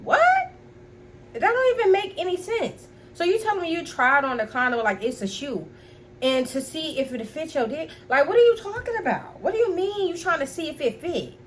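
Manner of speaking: high-pitched, baffled challenge to a silly excuse; incredulous. Retold or imitated. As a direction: question it in rising, exasperated disbelief. Disbelief at boyfriend's absurd excuse.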